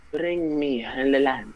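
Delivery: slow, drawn-out villain line; half-hearted imitation of a villain, slowly; Jafar impression attempt. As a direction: drag out the command like a mock villain. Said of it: "no, you gotta say it right".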